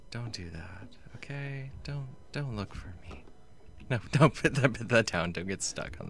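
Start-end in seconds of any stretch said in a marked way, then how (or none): 0.1-3.2 hushed unvoiced whisper; whispering while hiding. Real, not acted. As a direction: whisper so he won't hear. Hiding from pursuer.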